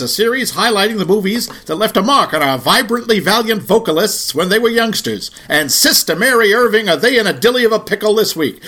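put-on old-time radio announcer in a Mid-Atlantic accent; high, brassy Mid-Atlantic newsreel delivery. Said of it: "that, uh, Mid-Atlantic accent".

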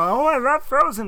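loud, high gushing fan voice. Retold or imitated. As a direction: gush like an overexcited fan. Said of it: "It's like, we're, you know".

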